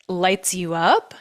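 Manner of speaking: ordinary talk, nothing marked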